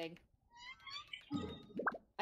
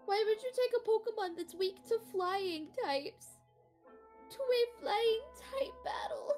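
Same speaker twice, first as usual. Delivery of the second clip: high-pitched wail of disbelief; exasperated high whine; 10 semitones higher than usual. Real, not acted. Gamer baffled by a type matchup, exasperated high whine. Baffled by opponent's Pokémon choice.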